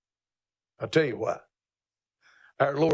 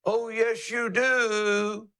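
higher, crowed words; a light rooster imitation, higher in pitch; 5 semitones higher, much slower than usual. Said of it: "buy you a rooster and listen to him".

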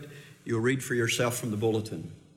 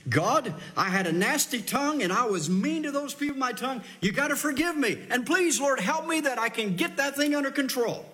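higher, pleading prayer of confession; 10 semitones higher than usual. Retold or imitated. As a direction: beg the Lord for forgiveness, pitched up. Voicing a true confession.

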